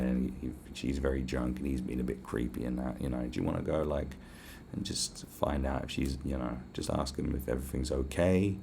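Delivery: low, soft, cordial reasoning; smooth, kind, polite. Retold or imitated. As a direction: talk an officer round gently and smoothly. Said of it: "As smooth and as kind and as polite".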